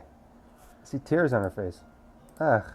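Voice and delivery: usual speaking voice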